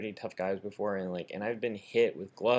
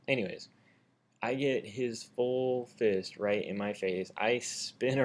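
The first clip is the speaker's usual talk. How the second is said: usual speaking voice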